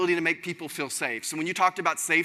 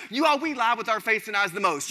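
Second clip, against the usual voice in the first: ordinary delivery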